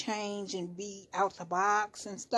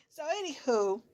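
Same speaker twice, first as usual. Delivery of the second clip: high squealing laugh at herself; 11 semitones higher, 7 dB louder than usual. Real, not acted. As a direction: crack up at her own bad accent. Laughs at own accent.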